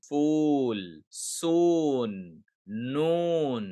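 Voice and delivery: फूल, soon, noon each with a long drawn-out "oo"; extended OO. Shown as a demonstration. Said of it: "sound अब extended होगा". (फूल, soon, noon में "ऊ" को लंबा खींचें)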